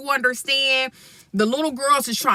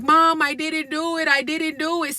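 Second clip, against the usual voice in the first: put-on pleading boy; 6 semitones higher than usual.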